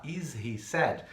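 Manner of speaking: "Is he sad?" drawn out very slowly; slow model question. Shown as a demonstration. Teacher reading the workbook question very slowly to students. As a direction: stretch every word for learners. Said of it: "Estão vendo lá?".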